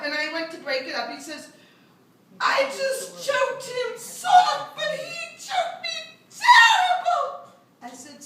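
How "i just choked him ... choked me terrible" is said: put-on very high, slow, louder child voice; whiny kid complaint. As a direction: kid protesting his case. Boy voiced in story.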